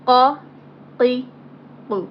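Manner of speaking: heavy throaty Arabic qaf; thick qaf. Shown as a demonstration. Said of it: "Same goes for kaf and qaf".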